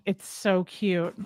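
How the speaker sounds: ordinary talk, nothing marked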